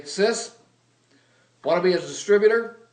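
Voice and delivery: just blurt it out at her; blurted pitch. Retold or imitated. Finally pitching his sister at dinner, a loud rushed blurt. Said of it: "and I say".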